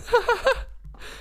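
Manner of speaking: squeaky burst of laughter; high-pitched laugh. Real, not acted.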